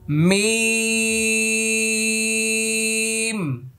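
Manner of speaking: মিম held very long and high, lips shut at both ends; slow tajweed demo of মিম; letter name drawn out. Shown as a demonstration. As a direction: hum মিম out slowly. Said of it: "কারণ মিম আসছে".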